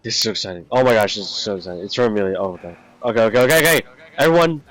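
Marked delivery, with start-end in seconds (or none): 3.0-4.6 excited calling out. Real, not acted. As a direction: call everyone to order, thrilled. Excited before reading, calling out.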